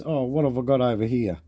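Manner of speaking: usual speaking voice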